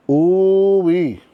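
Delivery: high, loud delighted whoop; excited whoop. Real, not acted. Toy reviewer whoops at the figure, delighted. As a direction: let out a thrilled ooh-wee.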